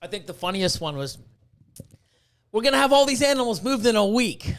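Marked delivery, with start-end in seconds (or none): 2.5-4.5 higher, louder retold big plan; mock-confident announcement; overeager pitch. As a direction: pitch it loud and overexcited, imitating himself. Retelling his excited plan to sceptical partner.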